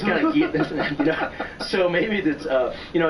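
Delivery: laughing through the words; speaking through a laugh. Real, not acted. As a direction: chuckle as you talk. Laughing at the story.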